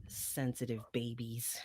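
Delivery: whispered mocking aside. Real, not acted. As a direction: breathe it out as a snide whisper. Mocking aside after accent joke.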